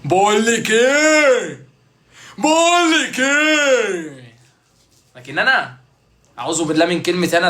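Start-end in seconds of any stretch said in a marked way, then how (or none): 0.0-1.7 slow stretched catchphrase. Shown as a demonstration. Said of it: "repeating catchphrase different ways". 2.4-4.3 بقول لك ايه said very high and very slow, falling at the end; stretched catchphrase. Shown as a demonstration. To the viewers, toying with the catchphrase, high and very slow. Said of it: "repeating catchphrase different ways".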